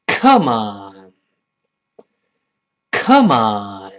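loud, heavy falling exclamation; angry Come on. Acted. Acting an angered foreigner, angry exclamation. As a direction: throw it out crossly, drawn to a drop. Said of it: "老外很生气".

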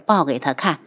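ordinary delivery, nothing marked

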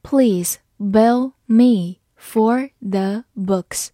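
Please bill me for the books, very slow; slow read. Shown as a demonstration. Teacher models the sentence slowly for the class. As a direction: drag out each word of Please bill me for the books. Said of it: "好，慢慢来读".

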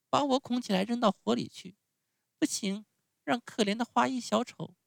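put-on ringing, very high child's voice; heroic Pinocchio, impassioned. A narrator's reading. Pinocchio offers to die for his friend, high and heroic. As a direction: declare it nobly as a small puppet. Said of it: "声调豪迈激昂".

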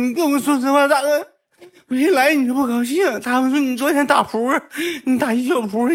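very high, squealed mock sobbing; imitated crying. Hurt junior accusing big brother of disliking them, mock crying. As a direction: wail it like a hammed-up crying junior. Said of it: "他就哭了".

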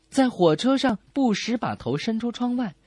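ordinary delivery

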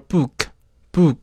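book said slowly with a bare final k and no vowel after it; slow, careful book, stopping dead on the k; clean English book. Shown as a demonstration. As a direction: correct English pronunciation of book. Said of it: "应该说".

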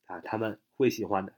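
normal talking voice

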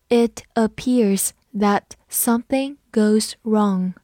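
slow, with a gap between words; slow reading. Shown as a demonstration. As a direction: model it slowly, word by word. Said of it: "我们慢读一遍".

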